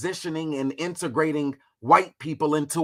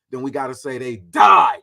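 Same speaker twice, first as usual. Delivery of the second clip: died hit much louder with a heavy fall; stress on died; 2 semitones lower, 8 dB louder than usual. Shown as a demonstration. Streamer telling chat how to say died, punched. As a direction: lay it on thick, landing on died. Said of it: "put it on a little thick like Mac lip gloss".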